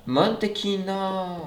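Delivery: mantequilla drawn out slowly in a put-on Portuguese accent; mock Portuguese accent. Reading a food label to viewers, hammed-up Portuguese accent. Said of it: "mon accent portugais".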